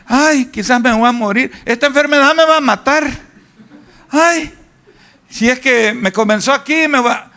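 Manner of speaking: high, fretful complaining with sighing ays; mock hypochondriac moaning about his illness; whiny worried hypochondriac. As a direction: play a worrier sure he is dying. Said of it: "aquellos que yo conozco... dicen".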